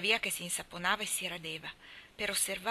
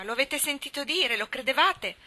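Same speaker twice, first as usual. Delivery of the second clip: high, loud, insistent cry; loud and raised in pitch; shouted questions; 6 semitones higher, 8 dB louder than usual. A narrator's reading. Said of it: "gli gridò come prima".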